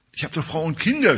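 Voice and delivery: a condemned prisoner's mock outcry in a sermon; mild retold cry. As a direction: cry out that he has a wife and children. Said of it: "schrie er".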